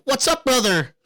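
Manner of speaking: lightly voiced streamer kid; imitating streamer catchphrase. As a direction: do the kid's hyped catchphrase. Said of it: "just go up to him with your finger and go".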